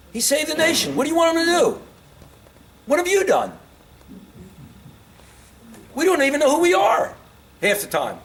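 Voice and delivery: high, loud exasperated rant at the critics; exasperated outburst. Real, not acted. Old man scolding Lincoln's critics, high and loud exasperation. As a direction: shout them down, fed up and incredulous. Ranting at present-day critics.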